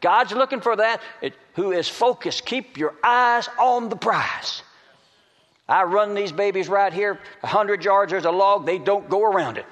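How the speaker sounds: high, emphatic, stretched key phrases; full-force preaching. Real, not acted. Preacher urges congregation to stay focused on the prize, at full force. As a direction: preach it high, drawing out the big lines.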